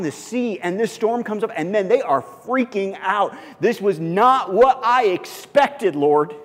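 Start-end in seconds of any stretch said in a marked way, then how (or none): 3.6-6.3 panicked disciples. Retold or imitated.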